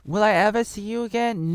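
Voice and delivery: high, put-on pleading voice; mock child's farewell. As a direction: ask it high, as a hammed-up little boy. Child's farewell in film parody.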